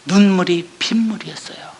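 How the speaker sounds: a breath of a voice, moved; tearful whisper. Real, not acted. Preacher tells the congregation of her tears in a whisper. Recalling tears while reading.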